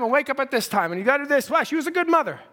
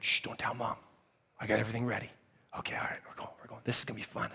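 shh, very soft whisper; whispering a secret; 14 semitones lower, 14 dB quieter, much faster than usual. Retold or imitated. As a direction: hush so Mom won't wake. Sneaking out without Mom.

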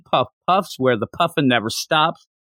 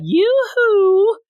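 flirty yoo-hoo; 3 dB louder than usual.

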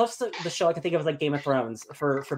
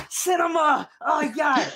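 high, loud shriek of delight; ecstatic cinema cry; 11 semitones higher, 5 dB louder than usual. Retold or imitated. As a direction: squeal like a thrilled film fan. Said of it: "And I'm just like".

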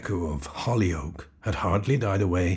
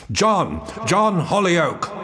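desperate shout, much higher and louder; 11 semitones higher, 9 dB louder, much slower than usual. Acted. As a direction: call his name with all your lungs. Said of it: "shouted with all the power of my lungs".

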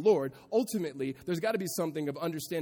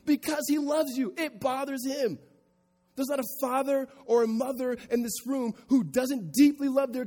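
higher, louder impassioned preaching; preaching at full force; 8 semitones higher, 7 dB louder than usual. Acted. Pastor urging parents and listeners, impassioned preaching. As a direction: plead with the room, full voice.

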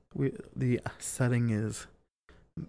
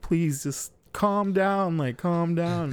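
higher, drawn-out plea to calm her; retold pleading; 5 semitones higher, slower than usual. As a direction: plead slowly, pitched up, imitating exasperation. Retold pleading with furious girlfriend.

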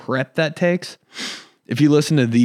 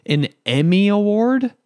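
slow, much higher scoffing question; mock incredulous; 8 semitones higher than usual. Scoffing at his dad's Emmy dream, slowly and high. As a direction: drag it out like it's absurd. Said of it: "I'm like".

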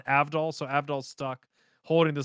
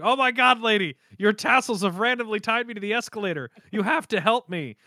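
higher-pitched exaggerated distress; mock panicked plea; 6 semitones higher than usual. Joseph pleading with a lady at the escalator, mock panic. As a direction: plead for help, hammed up.

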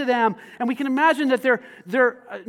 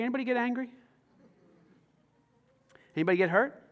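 ordinary talk

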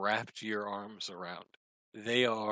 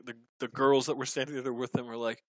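usual speaking voice

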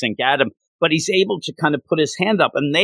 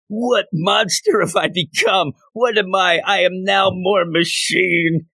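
high, slow, hammed-up lament; mock-tragic hero staring at his reflection; dramatic anguish; 6 semitones higher than usual. Tony at the mirror, hammed-up slow lament.

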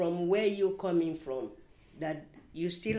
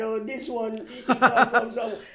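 amused teasing; 3 semitones higher, 6 dB louder than usual. Retold or imitated. Ladies' amused remark about her.

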